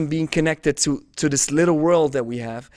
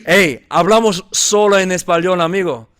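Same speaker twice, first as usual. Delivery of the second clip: put-on Cuban Spanish accent; 2 semitones higher, 6 dB louder than usual. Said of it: "We talk like this".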